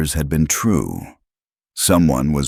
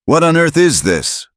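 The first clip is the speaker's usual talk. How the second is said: high, loud barked question; 13 semitones higher, 4 dB louder than usual. A narrator's reading. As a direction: bark it out. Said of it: "a voice barked".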